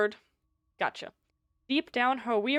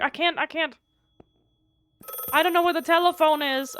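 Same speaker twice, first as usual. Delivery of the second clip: panicked yelling; 4 semitones higher, 5 dB louder than usual. Real, not acted. Panicking in a horror game.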